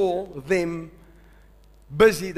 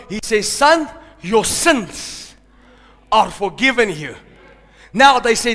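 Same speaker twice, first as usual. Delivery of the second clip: louder preaching, pushing each line; preaching at full force; 3 semitones higher, 5 dB louder than usual. Acted. Preacher telling the congregation of the paralytic, loud. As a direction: keep the pulpit fire going.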